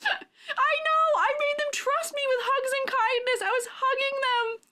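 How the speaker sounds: high, impassioned lament of betrayal; high, worked-up comic indignation; theatrical outrage. Real, not acted. Rants about betrayal of her critters.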